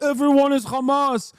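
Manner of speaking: put-on a sneering Israeli accent caricature of a Knesset member; mock Israeli accent. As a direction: parody a Knesset hardliner in a thick Israeli accent, drawing out "must go". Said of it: "talking like a reactionary Israeli member of the Knesset".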